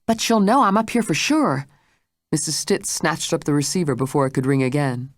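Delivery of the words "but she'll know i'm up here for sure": higher, louder frightened plea; alarmed plea. A narrator's reading. Blanche begs the maid not to answer, high and frightened. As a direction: beg her not to answer.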